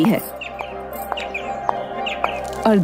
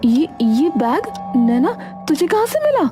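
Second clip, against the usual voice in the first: stammering, high-pitched alarm; taken aback, pitch jumping up; shocked mother; 8 semitones higher than usual. Acted. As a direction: question your child in startled disbelief. Mother shocked at new bag.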